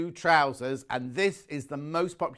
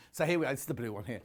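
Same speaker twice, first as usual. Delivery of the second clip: out of breath; 4 semitones lower, 7 dB quieter, much faster than usual. Real, not acted. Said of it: "I'm out of breath today".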